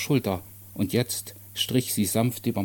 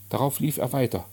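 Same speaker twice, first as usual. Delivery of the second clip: usual speaking voice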